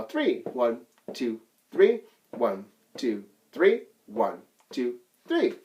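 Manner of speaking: steady teacher count-in; counting off three four time. Shown as a demonstration. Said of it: "counting off the beat".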